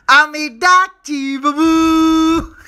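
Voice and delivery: slow, sneering film villain with a cackle; villain impression. Comic imitates a filmi villain, slow and sneering. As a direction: menace slowly like an old Hindi film baddie.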